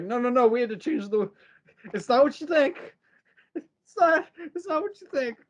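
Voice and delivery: high-pitched, flustered protest; mock panicked excuse. Jan immediately explaining herself to Charles, mock panic. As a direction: imitate a caught-out plea, pitch climbing. Retold Jan caught undressed.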